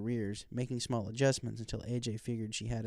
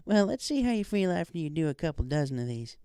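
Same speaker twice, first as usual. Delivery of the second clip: lightly voiced country mare, higher; a hint of a friendly farm pony, raised pitch; Applejack; 5 semitones higher than usual. A narrator's reading.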